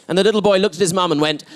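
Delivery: ordinary talk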